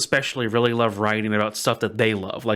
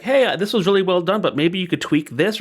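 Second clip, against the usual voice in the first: encouraging reader; 8 semitones higher than usual. Retold or imitated. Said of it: "when people are like".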